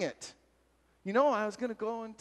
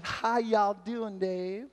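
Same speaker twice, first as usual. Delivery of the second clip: put-on Alabama accent; 4 semitones higher, much slower than usual. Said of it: "she's got a really cool accent".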